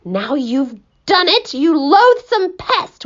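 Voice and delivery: plain reading voice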